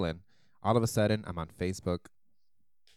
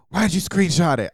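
loud, indignant demand; annoyed, loud; 8 semitones higher, 11 dB louder than usual. Retold or imitated. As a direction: call them out, annoyed. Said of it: "people be like".

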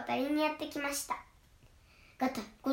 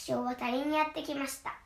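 plain reading voice

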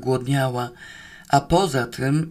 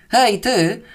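loud, higher shout down to someone; roared call; 7 semitones higher, 5 dB louder than usual. Acted. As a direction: holler to get attention. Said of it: "zaryczał cieć". (głośne, wyższe wołanie)

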